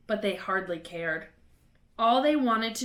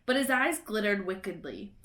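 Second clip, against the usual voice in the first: usual speaking voice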